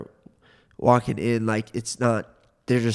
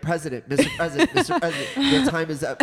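high, fast, laughing moderator calling time; mock moderator; 6 semitones higher, faster than usual. Friend imitating a debate moderator, laughing and fast. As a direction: cut in like a flustered debate moderator.

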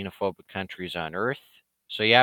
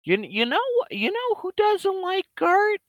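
high rhetorical tease. Acted.

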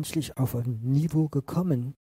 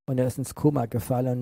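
ordinary talk

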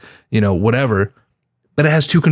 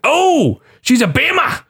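high, hammed-up Aussie shout; mock Aussie gamer taunt, pitched way up; hammed-up Australian accent with A's turned to E's; slower than usual. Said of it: "that's Australian, right?".

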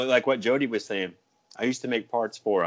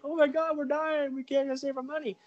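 high, whiny panicked everyman; hammed-up high worried whine; mock panic; 5 dB quieter than usual. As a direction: squeal it like the broke masses panicking. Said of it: "everybody else is like".

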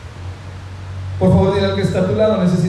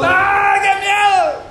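high-pitched mock scream of fright; frightened scream; 9 semitones higher, much slower than usual. Peter yelling along with all the frightened disciples, acted scream. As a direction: yell it out as a hammed-up panic. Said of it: "estaba igual gritando como todos".

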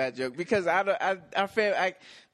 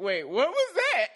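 mock surprise; 11 semitones higher, 4 dB louder, slower than usual. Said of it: "And I'm just like".

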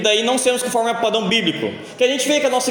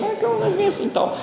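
much higher and faster, mock soothing; high quick reassurance; 13 semitones higher, faster than usual. Retelling how he calmed his wife, high and quick mock reassurance. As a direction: rattle off the calm-down line high and fast. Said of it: "tá falando".

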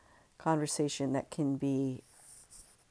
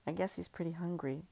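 quiet; 8 dB quieter than usual. Acted. Husband speaks gently.

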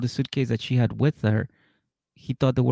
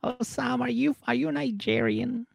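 very high, softer put-on voice; mock girlfriend voice; 12 semitones higher, 5 dB quieter than usual. Girlfriend asks Osama about himself, high mock cooing. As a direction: coo it high and hesitant, imitating.

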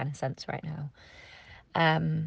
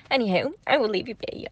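ordinary talk

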